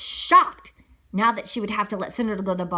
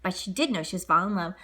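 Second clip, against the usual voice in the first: ordinary delivery, nothing marked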